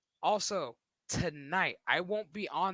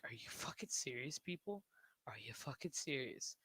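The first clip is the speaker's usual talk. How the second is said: whisper; 2 semitones lower, 9 dB quieter than usual. Retold or imitated.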